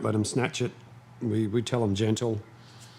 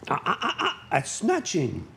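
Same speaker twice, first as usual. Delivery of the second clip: high sharp "ah ah" warning; warning the dog; 9 semitones higher than usual. Real, not acted. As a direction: cut the dog off mid-snatch. Dog snatching a treat.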